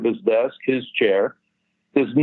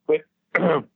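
imitated throat-clearing; 3 semitones higher than usual. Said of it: "he goes to talk to me again".

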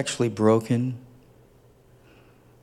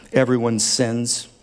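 normal talking voice

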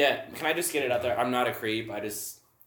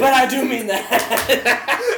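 high, loud, slow laugh-through line; 12 semitones higher, 10 dB louder, much slower than usual. Real, not acted. Joking about Linda to friends, laughing. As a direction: say it cracking up.

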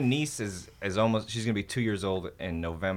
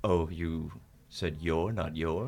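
low, slow alien pedant; 3 semitones lower, 4 dB quieter, much slower than usual. Retold or imitated. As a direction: correct them like an alien. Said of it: "they're like".

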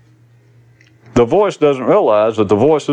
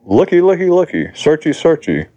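high, silly singsong; babbling mock-eager voice; inner voice, mocking; 5 semitones higher than usual. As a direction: chant it high like a childish searcher. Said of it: "This is voice".

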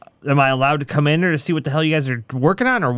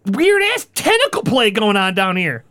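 ordinary delivery

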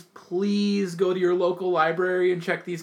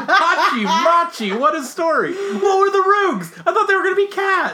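high laughing excitement; excited; 10 semitones higher, 5 dB louder than usual. Real, not acted. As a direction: gush about the story, laughing. Excited reaction to story.

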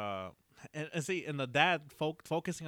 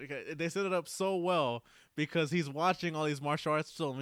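usual speaking voice

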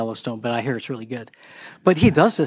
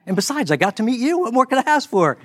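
gushing excitement; 10 semitones higher than usual. Retold or imitated. To Elwood at dinner, climbing into gushing excitement. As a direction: pitch climbing way up, eager and bright. Enthusiastic toast to new friend.

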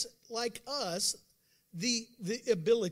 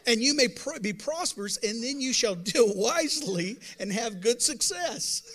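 much louder, full-force proclamation; booming preaching; 8 dB louder than usual. Real, not acted. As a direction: blast it out to the back row. Preacher booms scripture at congregation.

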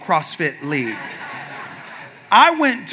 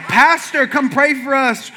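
higher-pitched imitation of old ladies calling; doting older ladies; 5 semitones higher than usual. Older ladies asking their pastor for prayer, high voice. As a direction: call out as a doting grandmother.

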